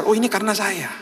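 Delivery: high-pitched retold realization; dawning realization. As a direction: act out suddenly catching on. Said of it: "Akhirnya dia sadar". (meniru orang yang baru tersadar)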